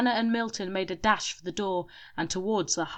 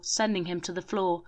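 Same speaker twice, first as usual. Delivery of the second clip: ordinary delivery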